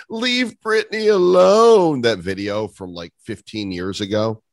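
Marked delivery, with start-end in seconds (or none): 0.1-2.0 high, loud, drawn-out plea; Chris Crocker. Retold or imitated. Podcaster mimicking a viral video, high loud wail. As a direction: wail it slowly at high pitch. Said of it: "Chris Crocker had that".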